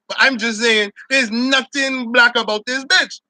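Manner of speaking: ordinary talk, nothing marked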